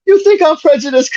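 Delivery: high blustering Archie Bunker impression. Archie to Lionel, high mock-offended. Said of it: "he's like".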